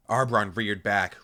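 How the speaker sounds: usual speaking voice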